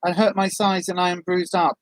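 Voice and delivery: ordinary talk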